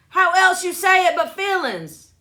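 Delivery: high, loud outburst of disbelief; indignant shout. Real, not acted. Defending their pronunciation to teasing listeners, indignant shout.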